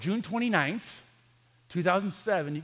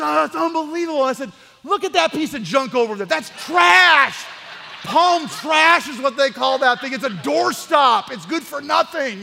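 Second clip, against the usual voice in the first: loud, laughing mock rant trashing the old phone; half-laughing, shouting scorn at the rival phone; hammed-up gloating, loud and higher, with laughter; mocking excited rant; 8 semitones higher, 11 dB louder than usual.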